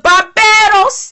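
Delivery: slow, drawn-out "Barbados" in a Barbadian accent; a light Barbadian accent on "Barbados". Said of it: "I just love [name]'s accent".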